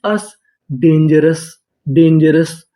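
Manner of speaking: Dangerous said slowly after अस; slow blending. Shown as a demonstration. Teacher blending sounds into dangerous for students, slowly. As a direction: stretch अस into dangerous. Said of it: "डीए".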